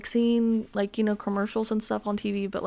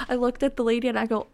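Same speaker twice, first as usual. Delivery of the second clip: ordinary talk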